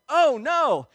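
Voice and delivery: loud, high mock dismay; retold alarm. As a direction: play the trainer's horrified exclamation. Trainer sees the dislocated shoulder.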